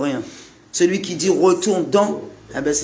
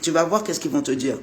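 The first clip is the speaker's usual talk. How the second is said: ordinary talk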